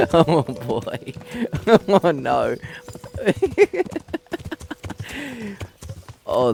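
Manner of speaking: real laughing fit between phrases. Real, not acted. Player cracking up over being blackout drunk, laughing. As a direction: crack up helplessly while talking.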